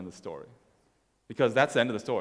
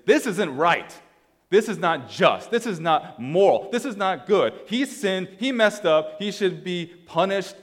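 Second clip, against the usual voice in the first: raised, indignant moral protest; disgusted older brother; 7 semitones higher, 5 dB louder, slower than usual. Acted. Older son judging his younger brother, indignant. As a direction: hammer each verdict, high and emphatic. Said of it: "the older son is disgusted because his thinking is".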